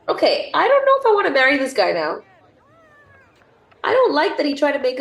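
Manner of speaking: fast, loud, high indignant reaction; exasperated outburst. Real, not acted. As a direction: balk at the suitor. Reacting to suitor's confession.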